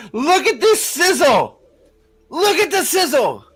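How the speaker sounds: excited hype. Real, not acted. Hyping the promo still.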